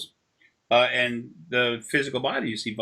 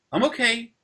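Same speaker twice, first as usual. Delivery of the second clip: higher-pitched imitation of a reassured pet; lightly voiced dog; 9 semitones higher than usual. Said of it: "she seemed normal again".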